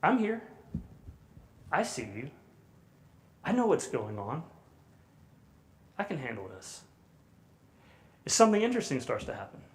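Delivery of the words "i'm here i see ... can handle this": soft, settling, fading to a hush; quietly steady a frightened child; calm reassuring parent. Retold or imitated. Parent calmly reassures a hurt child, softer and softer. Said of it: "they communicate something like this".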